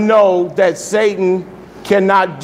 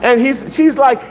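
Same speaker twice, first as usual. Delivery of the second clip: ordinary delivery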